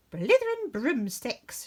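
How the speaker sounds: mild witch's cry of frustration; exasperated shout. Acted. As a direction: blurt it in exasperation. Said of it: "shouted Winnie".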